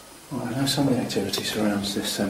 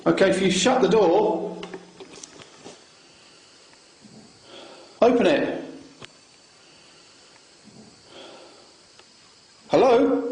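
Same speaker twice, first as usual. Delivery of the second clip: talking to a ghost; 10 semitones higher, 4 dB louder than usual. Real, not acted. Challenging spirit to open door.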